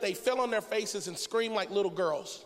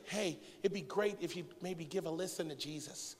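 soft, unemphatic polite request; mock mild suggestion; 3 semitones lower, 8 dB quieter than usual. Imagined God timidly asking disciples to listen, softly and mildly. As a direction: play it meek and tentative, no force at all. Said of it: "He didn't say".

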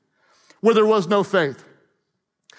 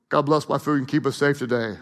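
bored small prayer; 9 semitones lower, 4 dB quieter, faster than usual. Shown as a demonstration. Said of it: "Or was it like".

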